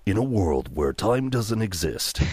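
slow, portentous trailer voice; movie trailer narrator. Shown as a demonstration. Narrating a film student's movie trailer, portentous voice. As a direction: intone it slowly like an epic trailer. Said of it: "I narrated this movie trailer".

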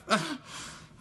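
squeaky lovestruck giggle; high giggle. Pretend. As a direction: let out a high, giddy giggle. Playful lovers' phone goodbye.

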